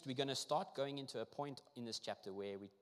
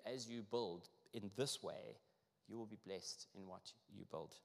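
ordinary talk, nothing marked

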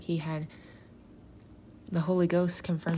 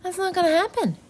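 dismissive refusal; 13 semitones higher, 7 dB louder, faster than usual. Retold or imitated. Peter voiced refusing the prophecy.

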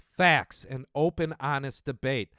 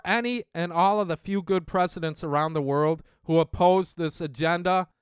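ordinary talk

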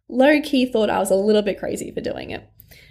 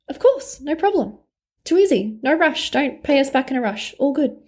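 much higher, light and easygoing reassurance; breezy family yes; 4 semitones higher than usual. Retold or imitated. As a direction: toss it off like it's nothing, all high and cheerful. Family readily agrees to loan.

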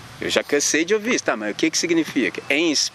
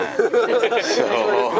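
laugh; 7 semitones higher than usual. Retold or imitated. Delighted at meeting Jesus.

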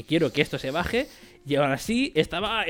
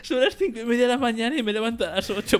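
mock whimper; 8 semitones higher than usual. Mock desperate plea at dawn.

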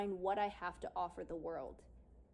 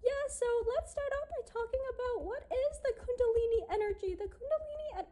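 much higher, fast nervous presenter; imitating a shaking voice; 4 dB louder, faster than usual. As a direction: act out stage fright with a high, shaky voice. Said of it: "I could feel my voice just shaking".

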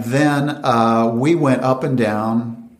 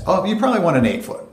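higher, fast bright pitch; eager salesman; 8 semitones higher, much faster than usual. Retold or imitated. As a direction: sell it quickly up high. Salesman voice to caller.

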